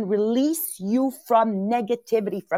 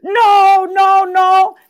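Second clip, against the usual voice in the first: high, loud frightened chicken protest; the timid inner voice refusing the roller coaster, in a high scared squawk; scared little chicken voice; 9 semitones higher, 8 dB louder, much slower than usual. Retold or imitated. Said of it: "that little chicken in me that goes".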